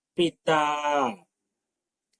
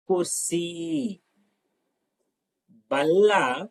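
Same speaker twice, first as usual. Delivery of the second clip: ordinary talk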